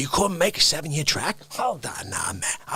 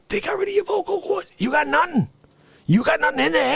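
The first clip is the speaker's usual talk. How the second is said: comic rant shout; 10 semitones higher than usual. Acted.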